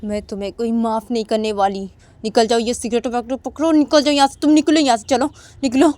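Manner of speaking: furious mother yelling him out, building to a loud shout; from cold scolding to a full shouting fit; angry scolding. Retold or imitated. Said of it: "अम्मा बोलती कि".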